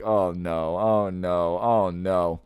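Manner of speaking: low, sinking repeated groan of worry; low-pitched mock dismay. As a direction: imitate a man dreading what's coming. Acted manager dreading as he listens.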